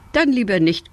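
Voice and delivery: ordinary delivery, nothing marked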